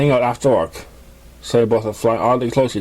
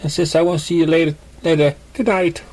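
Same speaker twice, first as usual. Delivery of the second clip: light character voice; 7 semitones higher than usual. Acted.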